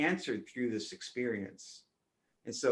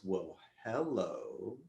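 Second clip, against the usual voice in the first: slow, low, drawn-out sinister hello; creepy greeting; 3 semitones lower, 5 dB quieter, much slower than usual. Retold or imitated. Dark soul answering the speaker's call, creepy slow greeting. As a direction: purr it like something lurking in the dark. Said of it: "turned around in this very creepy response".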